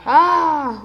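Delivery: sudden loud cry; startled yell. Real, not acted. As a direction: yelp in alarm.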